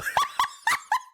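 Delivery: high squealing laugh. Real, not acted. High laugh at being asked about her best life.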